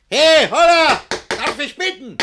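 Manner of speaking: angry shout. Acted. Master locked out, yelling at servants. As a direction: bellow with impatience. Master angrily calling outside.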